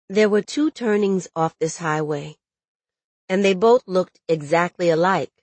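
ordinary delivery, nothing marked

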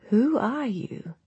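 put-on slow, high, frail old woman; weak elderly voice barely holding on. A narrator's reading. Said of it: "came the frail voice".

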